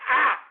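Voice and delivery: short loud "ah" of exasperation; frustrated yell. Real, not acted. Fed-up ranter losing his words, frustrated yell. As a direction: burst out with a wordless cry of frustration. Political rant, words fail him.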